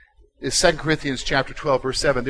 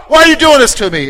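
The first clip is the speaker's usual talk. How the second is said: much louder, higher mock angry cry; vocal complainer; 9 semitones higher, 10 dB louder than usual. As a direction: shout it up at God in anguish. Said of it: "You got the vocal".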